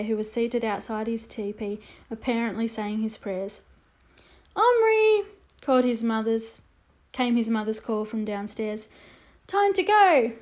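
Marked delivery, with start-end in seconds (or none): 4.6-5.2 mother calls up the stairs, higher and louder; calling out. Acted. Mother calling her son to leave for school. 9.5-10.3 mother calls up the stairs, higher and louder; calling out. Acted. Mother calling her son to leave for school.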